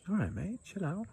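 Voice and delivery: high, soft mock calming voice; high mock placating voice. Mocking a bully, voicing him off.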